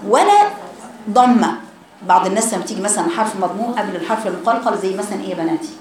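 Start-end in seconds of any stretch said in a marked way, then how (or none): none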